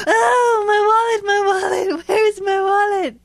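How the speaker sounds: high-pitched panicked wail, retold; freaking out. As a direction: wail it in mock alarm over a lost wallet. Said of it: "Mommy was freaking out".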